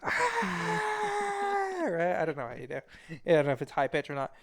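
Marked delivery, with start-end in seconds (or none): 0.0-1.8 mock yell. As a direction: belt out a piercing fake scream. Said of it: "she just hears you go".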